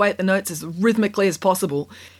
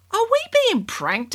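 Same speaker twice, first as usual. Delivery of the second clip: put-on suspicious girl voice. A narrator's reading. Kat suspicious.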